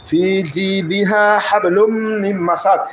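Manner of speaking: slow chanted recitation of ফি জি দিহা হাবলুম মিম মাসাদ; Quran recitation. Shown as a demonstration. Teacher reciting the full verse for the class: slow chant. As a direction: recite it slowly, tajwid style. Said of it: "থামার কারণে আমরা এই দুইটা জের বাদ দেব".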